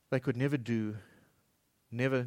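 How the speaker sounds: normal talking voice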